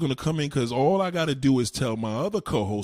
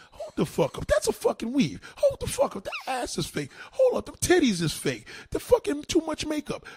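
mock outraged guy; 12 semitones higher than usual. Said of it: "'Cause you like".

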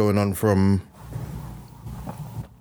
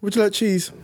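waiter voice; 9 semitones higher than usual. Retold or imitated. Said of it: "doing the faces".

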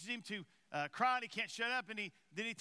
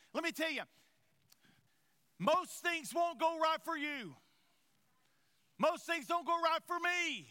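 high, raised, emphatic pulpit delivery; full-force preaching; 4 semitones higher than usual. Real, not acted. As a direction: proclaim it and challenge the congregation.

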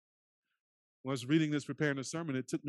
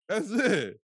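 more excited; 7 semitones higher, 7 dB louder than usual. Acted. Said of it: "this man created caller I D".

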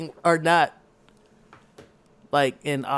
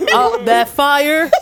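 slow, laughing, higher; words squeezed out through laughter; 9 semitones higher, 7 dB louder, slower than usual. Real, not acted. Laughing at own quote.

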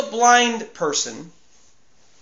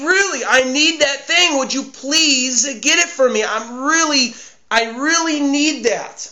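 insistent plea pitched higher; urgent pleading; 4 semitones higher than usual. Acted. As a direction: beg for it, stretching the key words. Acting out a second, urgent request.

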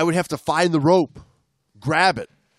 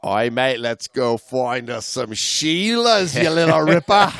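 mock Australian accent, louder at the end; 3 dB louder than usual. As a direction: fake Australian, building to a laugh. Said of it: "our accents aren't gonna carry us".

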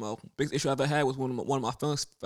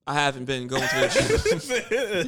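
laughing; 6 dB louder, slower than usual. Real, not acted. Confessing gym failure, laughing.

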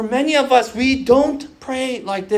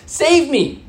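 high, raised, pleading cry; crying out to God; 7 semitones higher, 3 dB louder than usual. Retold or imitated. Enslaved people crying out to God, high pleading cry. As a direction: call out for rescue, high and insistent. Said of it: "they cried out to God".

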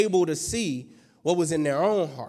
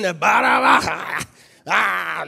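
mock devil roar; 6 dB louder, much slower than usual. Preacher mocking the devil people expect, mock devil roar. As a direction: roar like a hammed-up horned devil.

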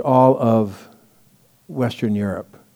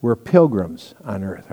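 ordinary talk, nothing marked

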